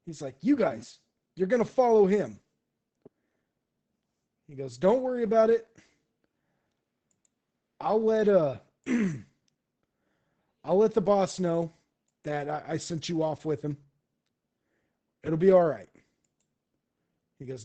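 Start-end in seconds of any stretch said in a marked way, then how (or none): 0.4-2.4 loud, raised-pitch command; boisterous boss instruction; giving orders. Acted. Kung Po orders mercenaries. 4.8-15.9 loud, hearty reassurance; rowdy boss settling it; boisterous, good-natured mercenary. Acted.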